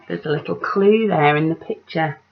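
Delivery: plain reading voice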